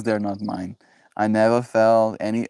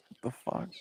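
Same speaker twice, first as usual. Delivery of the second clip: hushed, breathless whisper; whispered curse; 14 dB quieter than usual. Real, not acted. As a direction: drop to a stunned whisper. Stunned aside to himself.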